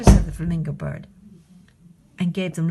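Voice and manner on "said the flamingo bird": ordinary delivery, nothing marked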